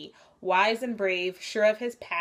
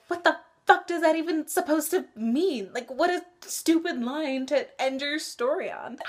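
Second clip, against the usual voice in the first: exasperated disbelief; 8 semitones higher, 4 dB louder than usual. Retold or imitated. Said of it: "which I was like".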